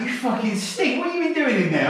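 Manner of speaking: very high-pitched mock outrage; scolding mum. Mum visiting her onion-smelling son, mock scolding. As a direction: imitate your appalled mum, way up high.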